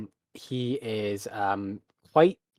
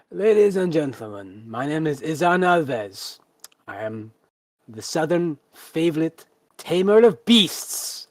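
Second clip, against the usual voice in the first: grand, slow ringmaster voice, pitched up; showman announcing himself; 5 semitones higher, 4 dB louder, slower than usual. Acted. Beast tamer introducing himself to the crowd, grand showman voice. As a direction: proclaim it like a circus ringmaster.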